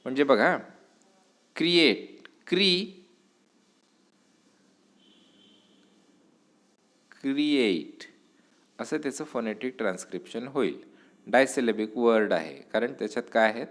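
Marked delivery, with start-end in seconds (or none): 1.6-8.0 create with Cre lifted higher; stress on first syllable. Shown as a demonstration. Teacher showing stress on Cre in create, raised pitch. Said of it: "दोन syllables आहेत".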